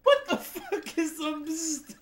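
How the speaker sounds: slow, high, near-laughing disbelief; incredulous. Real, not acted. Baffled by the runner's bzzt.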